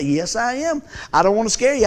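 ordinary delivery

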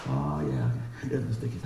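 very low, soft murmur to himself; sly aside. Retold or imitated. Shopper spotting his favorite cashier, low sly murmur. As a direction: play it cool, low under the breath.

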